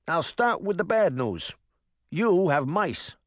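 the cat reports in New Jersey; mildly voiced New Jersey exterminator; New Jersey accent. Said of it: "in a thick New Jersey accent".

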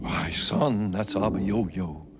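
low-pitched father answering his son; boy's father. Acted. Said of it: "the boy and his father woke up".

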